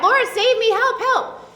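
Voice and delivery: a desperate but retold plea; crying out for help. A narrator's reading. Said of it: "he cried out".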